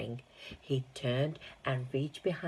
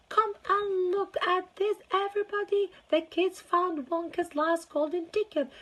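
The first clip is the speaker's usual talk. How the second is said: excited shouting; 6 dB louder than usual. Acted. Shopkeeper shouts to everybody.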